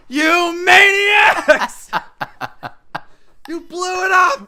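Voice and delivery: high, slow, hammed-up shout; Charlton Heston. Parodying the film's ending, a hammed-up Heston shout. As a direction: bellow it out in despair like the movie hero. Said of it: "Charlton Heston being like".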